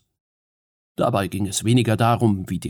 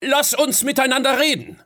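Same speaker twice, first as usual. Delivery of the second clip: much higher, raised urgent call; urgent appeal; 3 dB louder than usual. Acted. Fionn urges Queen Mab to stop, raised and urgent. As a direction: hold her back before swords move.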